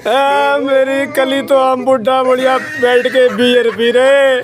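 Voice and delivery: half-laughing fake grief; mock sobbing. Old man fake-crying on request, half-laughing. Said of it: "रो के दिखा दो".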